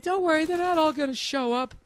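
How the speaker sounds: high breezy impression of his wife; wife's voice. Comic imitating wife shrugging off party worries, high voice. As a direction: mimic her carefree reassurance, high. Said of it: "She's like".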